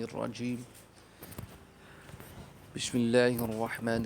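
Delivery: slow, measured Arabic invocation; prayer recitation. Acted. Teacher opening class for children with a solemn prayer. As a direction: recite the opening blessings devoutly.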